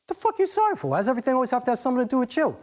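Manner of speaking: high-pitched, fast exasperation; indignant retort. Acted. Acted quarrel, snapping at an apology.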